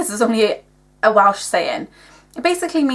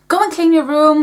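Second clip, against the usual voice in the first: higher, louder mock telling-off; imitated shouting mum; 6 semitones higher, 5 dB louder than usual. Mum shouting at her kid about the room, mock shout. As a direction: call it out like a nagging mum. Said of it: "my mum's shouting at me".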